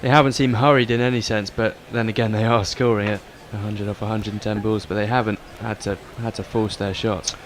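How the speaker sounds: usual speaking voice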